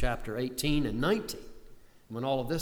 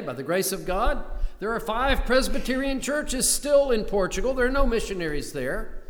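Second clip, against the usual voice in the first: louder, higher triumphant preaching; rising joyful proclamation; 7 semitones higher, 5 dB louder than usual. Real, not acted. Preacher telling the congregation about the churches in Portugal, louder and higher with joy. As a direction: lift it like good news.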